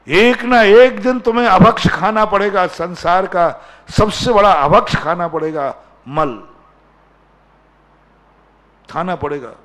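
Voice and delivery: loud sermon warning, starting high and easing down; forceful warning. Real, not acted. Preacher warning the congregation forcefully.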